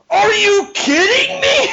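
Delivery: a high laughing mock shout; high, laughing mock outrage.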